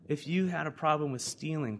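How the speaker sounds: ordinary delivery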